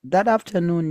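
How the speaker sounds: usual speaking voice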